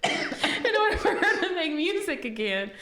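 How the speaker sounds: high, squeaky words breaking into laughter; real laughing. Real, not acted. Retelling a Family Guy joke to co-host, cracking up laughing. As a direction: struggle to finish the joke through giggles.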